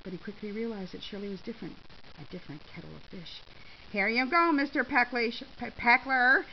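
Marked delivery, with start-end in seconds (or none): 3.9-6.4 loud, taunting handover; defiant. A narrator's reading. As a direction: throw it back at the accuser defiantly. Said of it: "Shirley said defiantly".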